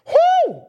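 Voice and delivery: very high loud whooping shout; full church whoo. Real, not acted.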